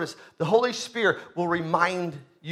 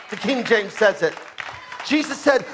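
usual speaking voice

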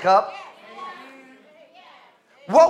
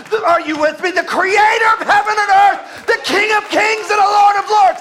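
full-force preaching; 8 semitones higher, 5 dB louder than usual. Acted. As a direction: thunder it out like a preacher declaring God's authority.

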